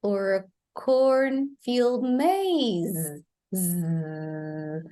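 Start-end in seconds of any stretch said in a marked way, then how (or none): 0.7-3.2 slow "cornfield maze" ending in a buzzed Z; "maze" with a Z ending and no E sound; voiced final Z. Shown as a demonstration. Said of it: "See, the Z sound, not the E". 3.5-4.8 low, long buzzing "zzz"; sustained Z sound. Shown as a demonstration. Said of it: "See, the Z sound".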